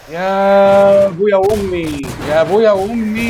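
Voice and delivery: high, loud, slow drawn-out cry; panicked wail. Real, not acted. Gamer wailing as mobs lift him, loud panicked wail. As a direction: wail it out in alarm.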